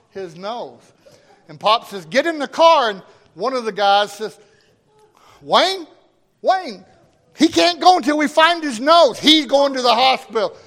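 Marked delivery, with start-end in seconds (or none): 2.0-2.9 firm order. Retold or imitated. Father ordering injured worker. 5.4-10.5 urgent protest. Retold or imitated. Coworker protesting to Pop.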